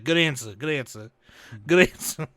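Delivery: put-on repeated New York accent approval; mock New York accent; New Yorker. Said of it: "doing a New Yorker".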